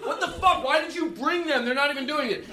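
hammed-up high-pitched outrage, laughing; mock frantic yelling. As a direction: rage half in jest at the friends who won't play. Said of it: "I was like".

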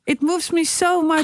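high, hammed-up emotional English; mock deeply moved. Co-host echoing the spoof, mock deeply moved. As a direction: play at being overcome by art.

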